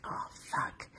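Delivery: hushed, voiceless "oh no" moment; whispered curse. Retold or imitated. Driver to herself as police lights come on, whispered. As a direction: curse under your breath. Retold dread as police pull up.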